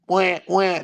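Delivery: high, loud mock baby cry; mock baby wail. Mimicking a crying baby while describing parenthood, mock wail. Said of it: "the baby's crying".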